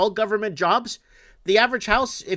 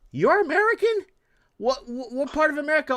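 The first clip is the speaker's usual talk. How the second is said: much higher, mock-disbelieving question; incredulous; 6 semitones higher than usual. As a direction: sound amazed he calls himself American. Incredulous reply to customer.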